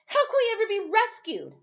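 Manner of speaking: high, loud angry demand; boy shouting at Timothy in anger. Acted. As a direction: blame him and shout it. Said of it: "I asked angrily".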